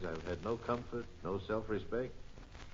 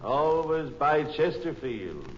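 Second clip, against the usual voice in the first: announcer slogan; 4 semitones higher, 6 dB louder than usual. Acted. Announcer's slogan, louder and slower.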